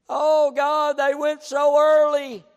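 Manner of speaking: loud, high cry to God; mock lament. People grieving loved ones too late, loud mock lament. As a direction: wail it out in hammed-up regret.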